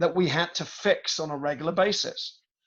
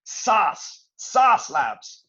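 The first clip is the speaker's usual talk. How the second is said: "sas Labs" in a put-on American accent; 3 semitones higher, 5 dB louder, much slower than usual. Shown as a demonstration. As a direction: say "sas" the American way, flat and quick. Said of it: "I have to say it in an American accent".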